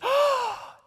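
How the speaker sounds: big delighted gasp; excited gasp. Acted. Crew member spotting the toy, a loud gasp. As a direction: gasp with surprise and joy.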